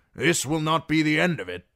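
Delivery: loud, high, agitated warning; forceful warning. A narrator's reading. Lord warns his host by the fire, loud and forceful.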